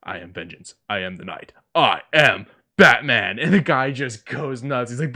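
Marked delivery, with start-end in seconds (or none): 0.0-3.4 Batman voice. Retold or imitated. Conroy doing Batman for a fan outside the mailbox. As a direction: growl it out like the caped crusader, ending big. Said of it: "And he just".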